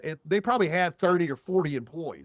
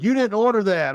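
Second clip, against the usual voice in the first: a higher, louder imitation of the snippy cashier; mocking the cashier; 7 semitones higher, 6 dB louder than usual. Imitating the drive-thru cashier accusing him, high and loud. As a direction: throw her accusing line back, hammed up. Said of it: "she was like".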